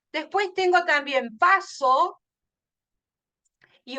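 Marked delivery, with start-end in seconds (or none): 1.4-2.2 PASO with a strong PA; stress on the A. Shown as a demonstration. Teacher showing the rhyme, stressed A in paso. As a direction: lean on the A of PASO. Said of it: "la A es la que se pronuncia más fuerte".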